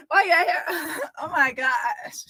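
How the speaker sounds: words broken by high giggles; helplessly amused, slowing on the last words; talking through laughter. Real, not acted. Laughing at the messy clip.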